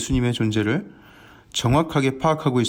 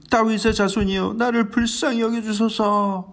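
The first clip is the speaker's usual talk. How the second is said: desperate cry; 6 semitones higher than usual. Acted. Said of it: "더 크게 예수님을 찾습니다". (높고 느리게 예수님을 부르짖는 간청)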